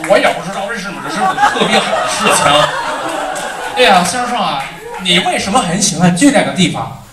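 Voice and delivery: lively Mandarin chatter; 普通话闲聊; Mandarin sample. Retold or imitated. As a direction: rattle off Mandarin lightly. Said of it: "That was Mandarin".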